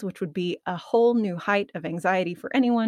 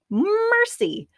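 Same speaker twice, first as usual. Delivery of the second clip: high, sudden exclamation of exasperation; exasperated exclamation; 4 dB louder than usual. Real, not acted. Tripping over her words, she cries out in exasperation. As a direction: throw up your hands, "Mercy!".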